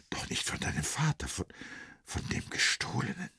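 hohes, ängstliches Flüstern; flüstere die bange Frage; geflüstert. Acted. Ängstliche Frage nach dem Gestohlenen. (Vrenchen fragt Sali bang flüsternd nach dem Diebesgut)

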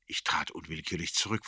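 ordinary delivery, nothing marked

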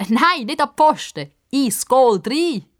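high, loud coaching call; calling out instructions. Acted. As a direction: urge a player on from the sideline. Coaching Pippa at the goal.

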